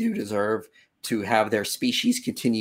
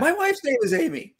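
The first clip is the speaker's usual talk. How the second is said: high, thrilled exclamation; can't believe the coincidence; delighted surprise; 3 dB louder than usual. Real, not acted. Shared wife name surprise.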